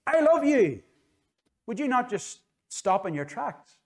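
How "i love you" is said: a slow, far-carrying street call, higher and louder; mock shout from a passer-by. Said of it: "somebody shouted and says".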